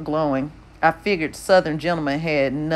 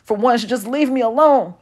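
rising voice; 8 semitones higher, 3 dB louder than usual. A narrator's reading. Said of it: "His voice was rising".

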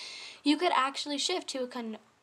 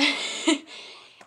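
breathy laugh; 5 semitones higher, 6 dB louder than usual. Real, not acted. Laughs at own story.

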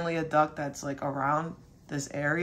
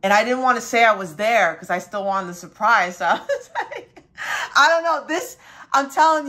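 laughing; 4 semitones higher, 6 dB louder than usual. Real, not acted. Laughing while telling story.

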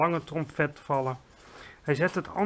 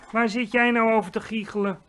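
higher, louder, offended question; rather miffed; 5 semitones higher, 5 dB louder than usual. A narrator's reading. GVR asks Sophie about her giggling, higher miffed tone. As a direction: demand it, a bit affronted. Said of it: "nogal in zijn wiek geschoten".

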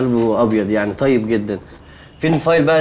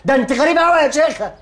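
exasperated outburst; 11 semitones higher than usual. Acted. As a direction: let the frustration spill over at her slowness. Exasperated at slow typist.

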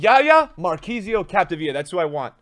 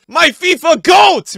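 loud, drawn-out, raised cry; proud shout; 7 semitones higher, 9 dB louder, slower than usual. Real, not acted. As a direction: belt it out proudly. Cheers favourite players.

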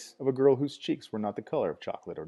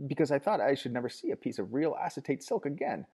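high, tearful rush of words; high sobbing crone voice; gushing old woman; 8 semitones higher, 4 dB louder than usual. A narrator's reading. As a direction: pour it all out to the visitor. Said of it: "the torrent of words flowed sobbingly".

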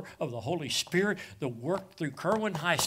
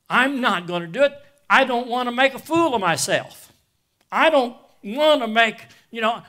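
retold defiance; 8 semitones higher, 10 dB louder than usual. Retold inner resolve, rising loud.

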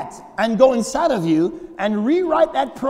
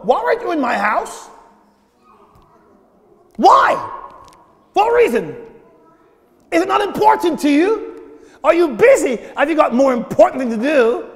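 demanding God; 3 semitones higher than usual. Retold or imitated. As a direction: press him for a reason.

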